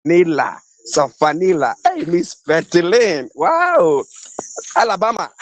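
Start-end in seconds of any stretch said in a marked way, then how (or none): none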